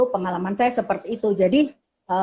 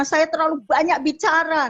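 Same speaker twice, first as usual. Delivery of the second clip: put-on child's complaint; 6 semitones higher than usual. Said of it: "dia bilang".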